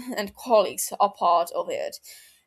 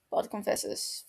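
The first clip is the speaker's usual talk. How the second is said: ordinary delivery, nothing marked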